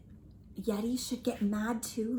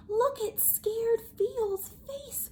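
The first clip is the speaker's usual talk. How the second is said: scared; 10 semitones higher, slower than usual. Acted. Mimicking the scared face.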